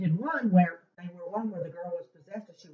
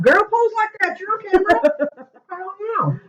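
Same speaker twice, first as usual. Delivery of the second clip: laughing; 8 dB louder than usual. Real, not acted. Laughing at a posing remark.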